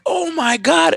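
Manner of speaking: panicked. Retold or imitated. Hearing dad and brother fight, high panicked cry. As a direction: gasp it out in alarm, voice cracking high. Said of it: "I'm like".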